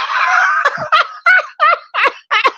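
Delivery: high shrieking burst of laughter. Real, not acted. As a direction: scream with helpless laughter. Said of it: "I'm crying in real life".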